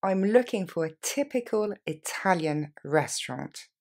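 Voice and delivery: slow, carefully paced model sentence; slowly. Shown as a demonstration. Teacher to learners, slow model sentence for repeating. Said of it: "on y va plus lentement".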